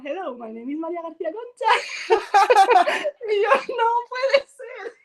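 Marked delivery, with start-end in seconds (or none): 0.0-1.7 put-on high, laughing Andalusian-accented English. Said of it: "en inglés". 3.8-4.9 high, slow, laughing cry of disbelief; hammed-up dismay, half laughing, half crying; mock horror. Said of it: "horrified reaction".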